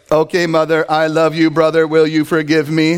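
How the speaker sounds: monotone robotic recital. Acted. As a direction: recite a forced apology like a machine. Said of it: "You turn into a robot".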